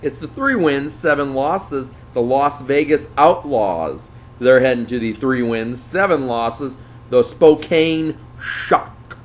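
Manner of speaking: big sports announcer build to the team name; announcer intro. Acted. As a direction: hype the matchup like a ring announcer. Announces matchup records.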